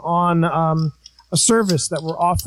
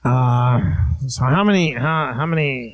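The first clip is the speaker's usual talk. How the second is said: Crazy Uncle Charlie voice; 4 semitones lower than usual. Acted. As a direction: growl it like a creepy uncle.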